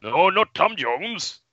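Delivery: comically drawn-out correction; odd put-on voice. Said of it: "What, what was that".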